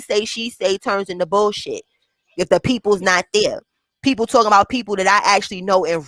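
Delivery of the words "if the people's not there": normal talking voice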